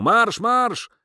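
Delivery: high-pitched marching order; yelled command. A narrator's reading. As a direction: yell the order from the front. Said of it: "ktoś od czoła wrzasnął".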